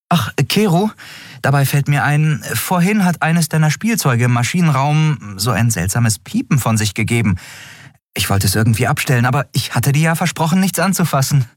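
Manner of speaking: put-on high, quick, offhand young captain; casual Endriel. A narrator's reading. Endriel lures Kero off the bridge, feigned casual afterthought. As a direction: toss it out high, as if just remembered.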